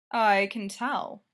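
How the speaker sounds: slow lazy drawl. Acted. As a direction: stretch it out, unhurried. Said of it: "Ryan drawled".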